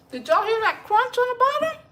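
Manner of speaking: delighted high squeal; excited squeal. Real, not acted. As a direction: squeal about the crunch. First bite of the biscuit.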